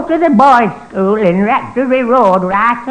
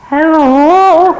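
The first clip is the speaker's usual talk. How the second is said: pitched-up, louder put-on old man hello; 5 semitones higher, 5 dB louder than usual. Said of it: "Use an old man's voice".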